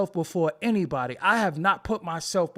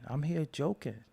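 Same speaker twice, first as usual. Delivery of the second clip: normal talking voice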